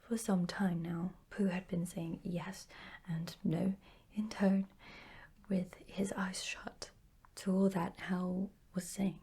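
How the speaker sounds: usual speaking voice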